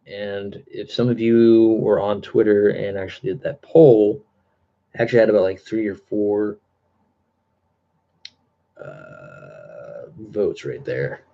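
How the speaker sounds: ordinary talk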